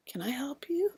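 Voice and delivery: hushed nurse. Retold or imitated. Nurse cautiously asks a wild-looking patient, whispering.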